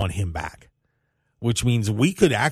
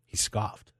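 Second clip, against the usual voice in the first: amused aside; 8 dB quieter than usual. Real, not acted.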